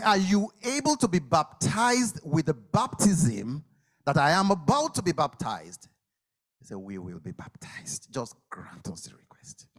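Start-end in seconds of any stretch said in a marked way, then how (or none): 0.0-5.9 challenging. Acted. Jesus challenges loudly. 6.8-9.7 hushed eager whisper. Acted. Disciples answer hushed.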